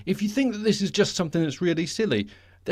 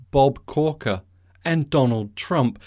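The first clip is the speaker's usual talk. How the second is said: ordinary talk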